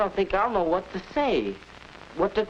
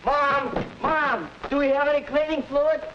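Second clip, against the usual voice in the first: calling out; 9 semitones higher than usual. Acted. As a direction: yell for Mom from another room. Son calling mother from afar.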